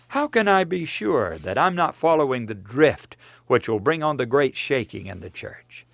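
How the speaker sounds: ordinary talk, nothing marked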